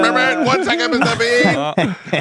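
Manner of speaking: very high, slow, drawn-out mock reply; hammed-up helper voice. The helper appearing out of nowhere, a very high hammed-up reply. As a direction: stretch it out like a cartoon helper.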